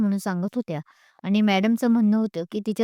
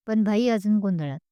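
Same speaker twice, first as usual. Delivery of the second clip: ordinary delivery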